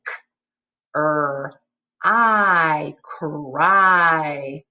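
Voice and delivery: short "K", held "er" and "I", blended "cry"; "K... er... I... cry", sound by sound. Shown as a demonstration. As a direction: segment "K", "er", "I", then say "cry" whole. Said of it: "put the beginning and middle sound".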